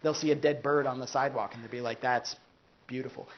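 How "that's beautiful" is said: apathetic. Retold or imitated. Brain-damaged person seeing a dead bird, apathetic. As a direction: lower and much softer, utterly flat. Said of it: "They'll say it so apathetically".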